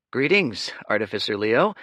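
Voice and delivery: thin smile. Acted. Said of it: "with a paper-thin smile".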